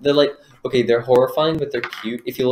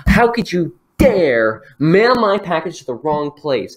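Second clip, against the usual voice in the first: angry customer rant; 6 semitones higher, 5 dB louder than usual. Acted. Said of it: "She's just going off on him".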